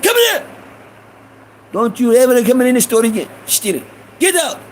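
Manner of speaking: high, angry storekeeper throwing someone out; mad Iranian shopkeeper. Retold or imitated. Shopkeeper bans a boy from the store, imitated high and angry. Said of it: "He getting mad".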